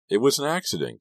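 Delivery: high-pitched protest of innocence; distressed plea. A narrator's reading. Kerry defending himself to his friend, distressed plea.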